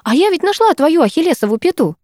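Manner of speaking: high, delighted announcement. Acted. Майя triumphantly tells her friend a discovery. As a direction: announce it with glee. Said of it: "восторженно сообщила я".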